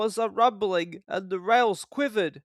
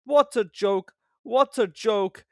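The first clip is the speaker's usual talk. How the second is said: louder, slow gloating; chortling Gordon; 5 semitones higher, 5 dB louder, slower than usual. A narrator's reading. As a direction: gloat with glee. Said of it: "he chortled".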